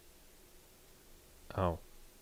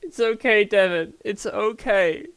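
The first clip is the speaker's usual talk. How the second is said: much higher and louder put-on apology; mock high voice; 10 semitones higher, 6 dB louder than usual. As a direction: squeak the apology out in a hammed-up character voice. Mock soothing after mic joke.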